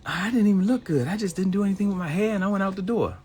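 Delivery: high, loud whiny put-down; mock self-pity. Mimicking women who run themselves down, high and whiny.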